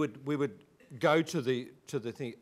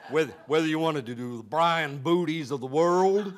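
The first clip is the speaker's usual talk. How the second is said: loud, higher put-on American accent; 4 semitones higher, 10 dB louder than usual. Speaker showing which America to come from, put-on American accent. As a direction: exaggerate an American regional accent loudly. Imitating a regional American talker.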